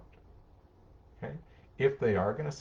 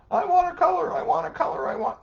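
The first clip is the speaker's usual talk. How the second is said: put-on much higher, loud whiny child demanding; whining child; 11 dB louder, faster than usual. Counselor voicing a child who demands to color, high and whiny. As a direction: play a demanding little kid, pitched way up.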